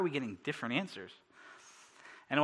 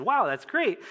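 higher, louder burst of delight; pleased amazement; 9 semitones higher, 6 dB louder, slower than usual. Retold or imitated. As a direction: react to great news, delighted. Retold happy reaction to good news.

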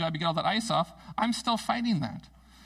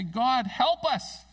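slow, louder, higher plea; crying out to God; 6 semitones higher, 4 dB louder, much slower than usual. Retold or imitated. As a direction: stretch out a desperate cry for help. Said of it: "they cried out to God".

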